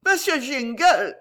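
high, pleading lady's call; imploring. A narrator's reading. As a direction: beg him by name. Lady begs the gentleman. (appel suppliant et aigu)

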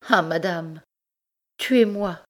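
high, anguished plea; desperate plea. Acted. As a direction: cry out in despair. Desperate wife begs to die.

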